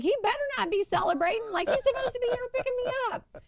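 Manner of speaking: high, fast indignant complaint. Retold or imitated. Girlfriend fumes to stranger, high fast indignant. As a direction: huff at the absent boyfriend. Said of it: "I was like".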